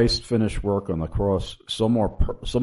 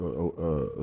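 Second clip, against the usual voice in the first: usual speaking voice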